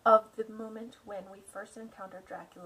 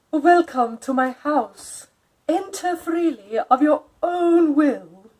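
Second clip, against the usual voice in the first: slow, louder count with an odd lilt; Dracula's welcome; 6 semitones higher, 14 dB louder, slower than usual. Acted. Count Dracula greets Jonathan at his door, slow and eerie. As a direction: welcome the guest with eerie courtesy. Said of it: "with a strange intonation".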